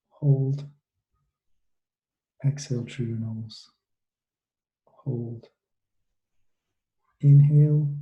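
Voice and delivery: soothing meditation guide, unhurried and hushed; guided meditation. Acted. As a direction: ease them gently through the body scan. Leads a guided body-scan meditation.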